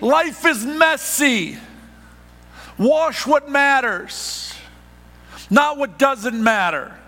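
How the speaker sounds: drawn-out, high, loud key line; slow emphatic slogan. Acted. Pastor to congregation, slowly hammering home the motto. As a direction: lean on each word of the motto. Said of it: "slow emphatic key phrase".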